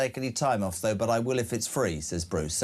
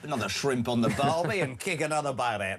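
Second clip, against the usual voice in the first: put-on Australian accent. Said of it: "It's okay, mate".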